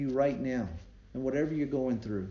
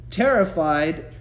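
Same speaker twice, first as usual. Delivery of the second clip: normal talking voice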